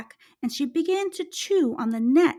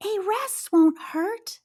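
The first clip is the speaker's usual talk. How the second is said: mouse voice; 6 semitones higher than usual. Acted. Tired mouse thinks aloud.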